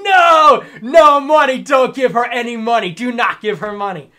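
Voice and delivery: loud high yell, easing as it goes; screaming in chat. Retold or imitated. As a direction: holler a frantic warning at someone. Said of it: "And I'm in chat screaming".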